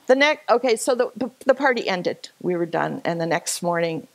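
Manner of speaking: usual speaking voice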